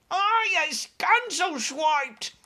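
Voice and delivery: very high, slow, loud giant shout. Acted. Roped giant shouts his protest, very high and slow. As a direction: drag the words out in a furious shout. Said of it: "shouted the meat dripper".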